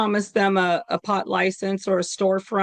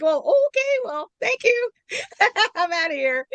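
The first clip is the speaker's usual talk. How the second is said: very high-pitched, laughing mock politeness; awkward polite retreat; 11 semitones higher than usual. Replying to the neighbor's "you people", hammed-up awkward polite exit. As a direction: squeak out a polite brush-off through giggles. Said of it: "I'm like".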